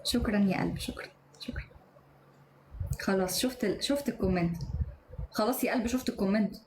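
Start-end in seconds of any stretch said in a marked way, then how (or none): 0.8-1.6 breathy whispered thank-yous under the breath; hushed aside thanks. Real, not acted. Quiet aside thanks after reply.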